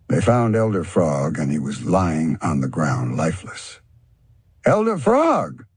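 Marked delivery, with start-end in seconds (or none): 4.6-5.6 slow, much higher cry of alarm; cried out. A narrator's reading. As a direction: call out to the lifeless elder. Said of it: "the panther cried".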